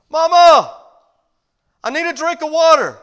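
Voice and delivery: put-on high, loud little boy calling Mama; child calling out. Little boy calls from upstairs to his mama, high and loud. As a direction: call up the stairs like a scared little boy. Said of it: "boy calling again".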